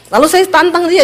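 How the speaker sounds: normal talking voice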